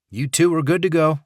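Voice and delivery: cheerful Southern guard waving them on; friendly guard voice. Acted. Dixon waves them through.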